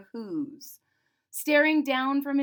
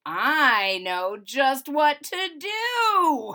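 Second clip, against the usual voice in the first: gleeful Grinch; 3 semitones higher, 6 dB louder, slower than usual. A narrator's reading. Grinch gets his awful idea.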